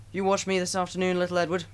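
high, proud boasting voice; lightly voiced show-off showing off to little Edward; boastful big engine. A narrator's reading. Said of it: "he boasted".

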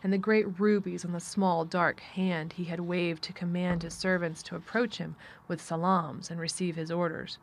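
plain reading voice

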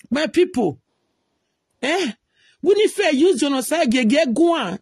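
rally the people, voice up; heated rant. Real, not acted. Vlogger rallying his followers against Winifred, heated call.

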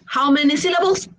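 usual speaking voice

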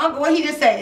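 high, puzzled question; confused aside. Retold or imitated. To her uncle, confused by the coworker's Spanish, high and puzzled. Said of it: "I was like".

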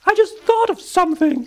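very high, loud squeak; field mouse voice. A narrator's reading. Field mouse announces a sudden idea, squeaky.

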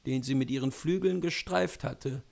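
ordinary delivery, nothing marked

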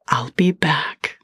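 slow, hushed promise; whispered promise. Acted. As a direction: whisper it slowly, a vow. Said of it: "she promised".

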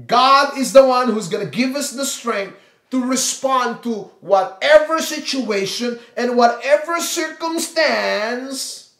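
building sermon climax; fervent preaching. Acted. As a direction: preach each word out to the room. Preaching to the congregation.

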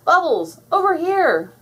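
high, loud call for rescue; yelling. A narrator's reading. Classmates in a whirlpool to Bubbles, yelling. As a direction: yell to get her attention. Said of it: "yelled a group".

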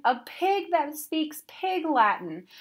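high, drawn-out amazement; astonished exclamation. A narrator's reading. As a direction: marvel slowly at something unheard of. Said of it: "Frypot exclaimed".

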